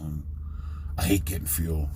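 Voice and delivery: understated, mocking gripe; pissed-off trucker. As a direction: imitate a driver moaning under their breath. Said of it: "I'm pissed off even worse".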